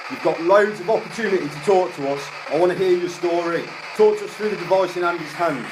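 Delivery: loud, high, insistent summons; commanding. Real, not acted. Ghost hunter urging spirits to talk through a device, commanding. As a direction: press the spirits to answer.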